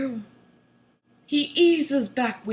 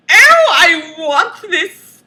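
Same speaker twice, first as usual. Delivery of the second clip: loud mock-passionate cry, pitched up; hammed-up ecstasy; 5 semitones higher, 10 dB louder than usual. A narrator's reading. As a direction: oversell the lust for laughs. Moaned line in erotic reading.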